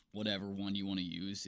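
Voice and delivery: ordinary talk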